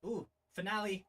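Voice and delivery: plain reading voice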